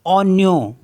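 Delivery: ओन्यो, gn as ny, not English onion; French ओन्यो set against English onion; French pronunciation. Shown as a demonstration. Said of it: "लेकिन इसको पढ़ेंगे हम".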